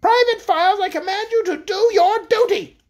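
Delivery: high, loud, furious royal command; furious cry. A narrator's reading. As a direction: command him at the top of your range. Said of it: "she cried again".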